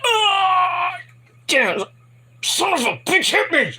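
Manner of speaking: high, strained cry of pain; hammed-up agonized cursing; mock pained yell. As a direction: howl as if just whacked in the back. Acting out man hit with chair.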